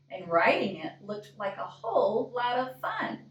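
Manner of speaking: plain reading voice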